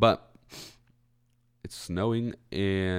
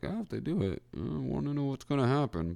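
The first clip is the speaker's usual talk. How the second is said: curious inner voice; 8 semitones higher than usual. Retold or imitated. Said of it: "But I was also like".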